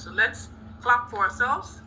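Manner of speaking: ordinary talk, nothing marked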